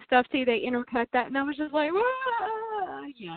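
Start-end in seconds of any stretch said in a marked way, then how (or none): 1.9-3.1 long, high-pitched mock wail; imitated wail. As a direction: draw out a high, soft wail. Imitates own reaction to the scene.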